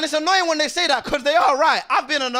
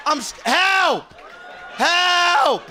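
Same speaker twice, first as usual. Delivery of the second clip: drawn-out, raised comic call; mock cry for help; 6 semitones higher, much slower than usual. Passenger falling from the sky with a whistle, drawn-out mock cry for help.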